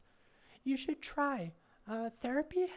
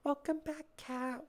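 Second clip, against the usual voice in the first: excited; 5 semitones higher than usual. Real, not acted. Greeting returning viewer.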